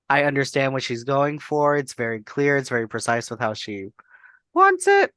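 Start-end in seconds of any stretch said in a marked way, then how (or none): 4.5-5.1 sudden high, louder squeak. Real, not acted. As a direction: pop up into a squeak.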